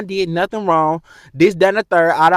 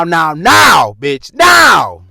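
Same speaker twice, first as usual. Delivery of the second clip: shouted demand; 2 semitones lower, 8 dB louder, much slower than usual. Real, not acted. Vlogger demanding Q and A questions, shouting. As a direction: bark now at the viewers. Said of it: "give me y'all Q and A questions now".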